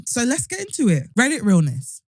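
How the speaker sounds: ordinary delivery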